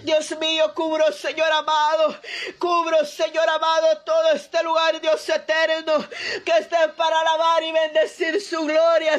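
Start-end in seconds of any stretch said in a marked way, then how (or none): none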